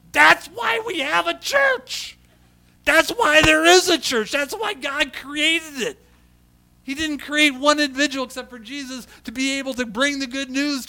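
preaching at full force. Real, not acted. Pastor rallies the congregation about the church, preaching at full force. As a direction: cry it out to the congregation like a revival climax.

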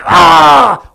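loud, high frustrated yell; gritted-teeth cry. Shown as a demonstration. Preacher showing teeth gritting together, loud straining yell. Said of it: "is gritting together like".

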